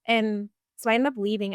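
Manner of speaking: usual speaking voice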